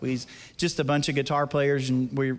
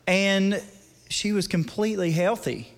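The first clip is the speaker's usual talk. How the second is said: ordinary talk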